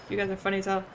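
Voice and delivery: ordinary delivery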